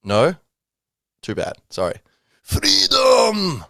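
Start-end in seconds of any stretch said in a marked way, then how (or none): 2.4-3.6 high, loud battle cry in a put-on Scottish accent; Scottish-accented shout. William Wallace shouting to the sky in a rough Scottish accent. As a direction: bellow it like a mock Scottish warrior. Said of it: "should I put on a Scottish accent?".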